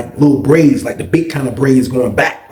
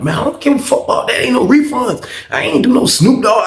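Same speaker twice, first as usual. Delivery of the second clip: high, quick, indignant retort; defiant brush-off; 9 semitones higher than usual. Retold or imitated. Tattoo artist refusing refunds to the teller, defiant brush-off. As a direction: wave the complaint away in a pitched-up voice.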